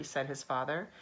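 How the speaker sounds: plain reading voice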